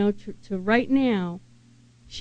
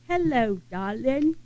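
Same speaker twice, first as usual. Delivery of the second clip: a light hint of an English Liverpool accent on "Hello, darling"; 3 semitones higher than usual. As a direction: gently imitate the old Englishwoman's English greeting. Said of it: "she had this accent".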